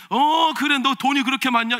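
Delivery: a loud mocking sneer; sarcastic jab. Mother-in-law sneering at her daughter-in-law, loud sarcasm. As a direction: jeer at her spending. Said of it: "비아냥대고".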